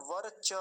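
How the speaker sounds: वर्च slowly as var-cha, vowel complete; full cha. Shown as a demonstration. Teacher stressing that चकार is full, full cha. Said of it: "चकार को पूरा करेंगे".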